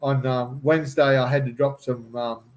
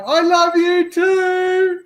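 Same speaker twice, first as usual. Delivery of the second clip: much higher, slow, loud called-back answer; imitating Margie's reply; 6 dB louder, much slower than usual. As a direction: sing it back sweetly from afar. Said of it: "you hear this on the way back".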